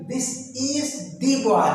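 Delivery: put-on this is the boy said very slowly, word by word; slow model sentence. Shown as a demonstration. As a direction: read it out slowly for the class. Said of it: "यहाँ पर this का प्रयोग करोगे". (धीरे-धीरे पढ़ा गया वाक्य)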